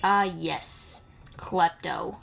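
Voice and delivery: ordinary delivery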